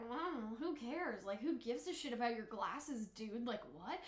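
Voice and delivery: cold, uncaring Levi; dismissive Levi. Retold or imitated. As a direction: toss it away like nothing matters. Levi voiced dismissing Zeke.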